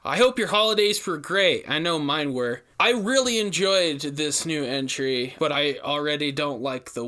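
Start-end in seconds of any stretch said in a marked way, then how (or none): none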